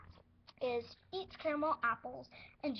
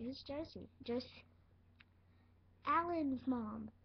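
ordinary talk

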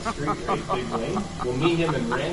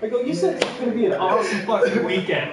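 laughing retold outrage; 8 semitones higher, 5 dB louder than usual.

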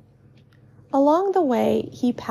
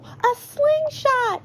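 high, drawn-out excited cry; slow, high-pitched mild yell; Tallow, excited yell; slower than usual. A narrator's reading. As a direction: marvel at it in a high kid voice. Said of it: "he yells".

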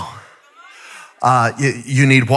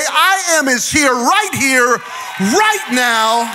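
preaching at full force; 6 semitones higher than usual. Acted. Preacher calling the church to praise, building to a shout. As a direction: preach it higher and higher to the crowd.

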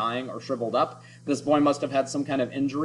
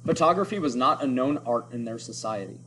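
ordinary delivery

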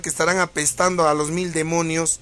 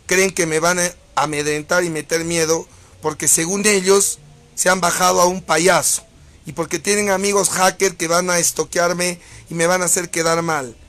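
usual speaking voice

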